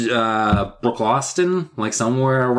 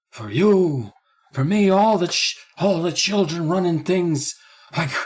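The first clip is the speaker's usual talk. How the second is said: slow mock Joe Biden drawl, pitched high; Biden accent; 7 semitones higher, slower than usual. Host riffing on kids becoming boss, mock Biden accent. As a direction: slip into a jokey Biden impression. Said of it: "that accent all of a sudden".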